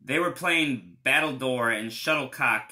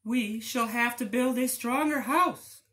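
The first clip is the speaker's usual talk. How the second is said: very high small voices; tiny wolves deciding; squeaky little wolves; 11 semitones higher than usual. Acted.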